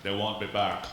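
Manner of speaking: put-on a light Austrian accent as Arnold; mock Austrian action hero threat; a hint of Arnold's Austrian accent, deadpan; Arnold Schwarzenegger. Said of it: "I could be Arnold Schwarzenegger".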